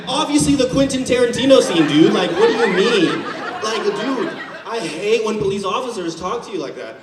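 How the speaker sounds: ordinary talk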